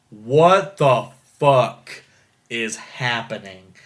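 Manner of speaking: very slow, drawn-out disbelief; stunned bafflement. Retold or imitated. Retold viewer's stunned reaction.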